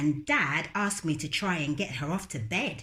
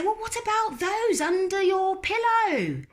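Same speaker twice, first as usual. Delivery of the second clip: high, quick teasing brother; lightly voiced teasing brother; 13 semitones higher than usual. Brother teasing Lola about bed, quick. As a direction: tease her playfully.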